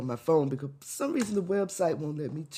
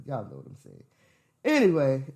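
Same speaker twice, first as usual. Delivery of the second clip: normal talking voice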